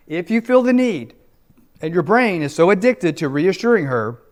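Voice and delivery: normal talking voice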